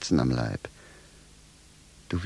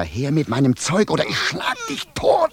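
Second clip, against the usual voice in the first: high, loud furious threat; angry demand; 9 semitones higher, 3 dB louder than usual. Acted. Shoemaker demands his clothes back, high furious shout. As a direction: shout him down. Tug-of-war over clothes.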